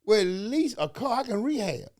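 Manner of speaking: ordinary talk, nothing marked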